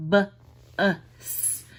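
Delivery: "B-us" split into "b" and "us"; segmenting a word. Shown as a demonstration. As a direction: break "B-us" into its sounds. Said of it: "and bus".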